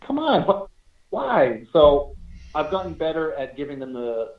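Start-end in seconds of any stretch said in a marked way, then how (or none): 0.0-1.6 retold disbelief, pitch jumping up; mock exasperated pleading; incredulous protest. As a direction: object as if you can't believe the request. Retells protest at spirit's demand.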